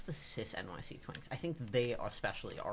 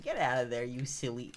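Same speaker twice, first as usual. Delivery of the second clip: teasing; 8 dB louder than usual. Pretend. Teasing chat for guessing right.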